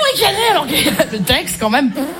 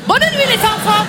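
put-on higher, curt German-accented goodnight; German babysitter accent; 4 semitones higher than usual. Babysitter saying goodnight to children, hammed-up German accent. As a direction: sign off with a mock German accent. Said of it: "Humour germanique".

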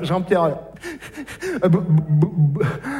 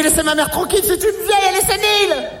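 yelling down the phone; 10 semitones higher than usual. Acted. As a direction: bark it down the phone line. Yells at alien on phone.

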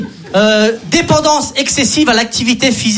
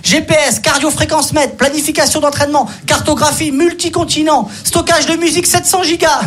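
show-off runner listing gadgets, pitched up; higher smug gym-bro voice; boastful runner; 5 semitones higher than usual. Retold or imitated. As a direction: brag about the watch like a gear snob.

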